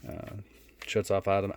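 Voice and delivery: usual speaking voice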